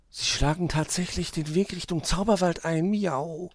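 high little mouse, excited but held back; voiced stage whisper, high and eager. A narrator's reading. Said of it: "flüsterte Maus aufgeregt". (aufgeregte Maus, hoch und verhalten)

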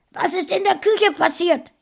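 high, loud roar of outrage. A narrator's reading. Mother bursting into her son's room, roaring. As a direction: bellow it high and hard. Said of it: "fragte sie brüllend".

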